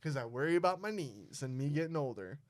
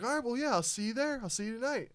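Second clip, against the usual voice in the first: much higher, cheerful coach; coach's voice; 9 semitones higher, faster than usual. Retold or imitated. Coach confirming practice tonight, high and cheerful. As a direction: bounce it up like an upbeat coach. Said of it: "And he's like".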